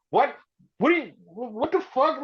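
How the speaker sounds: stammering high disbelief; baffled. Retold or imitated. Punk stunned by the headlining question, high sputtering confusion. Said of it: "he's so fucking baffled".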